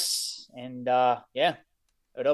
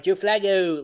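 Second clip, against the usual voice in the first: Baltimore drawn-out final O, higher and louder; Baltimore accent; 6 semitones higher, 8 dB louder, slower than usual. Retold or imitated. Cohost imitating locals saying a player's name, Baltimore accent. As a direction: mimic the Baltimore O, punched up. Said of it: "you just had the O at the end".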